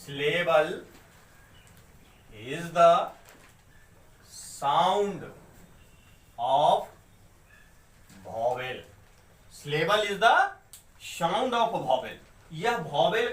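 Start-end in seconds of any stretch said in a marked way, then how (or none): 0.0-8.9 word by word, with long gaps; dictation pace. Shown as a demonstration. Teacher writes the definition for the class, slow dictation. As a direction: dictate it slowly while writing it out. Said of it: "तो मैं लिख सकता हूं".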